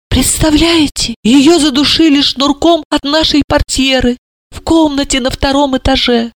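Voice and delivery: plain reading voice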